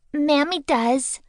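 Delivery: put-on high, slow childish tattle; innocent toddler. A narrator's reading.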